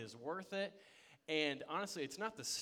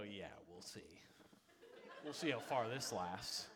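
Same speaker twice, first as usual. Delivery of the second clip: sassy doubt; 6 semitones lower, 4 dB quieter than usual. Retold or imitated. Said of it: "a really sassy sister".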